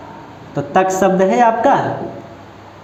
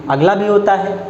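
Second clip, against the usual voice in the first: ordinary talk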